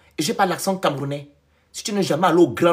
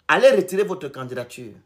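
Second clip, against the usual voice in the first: ordinary talk, nothing marked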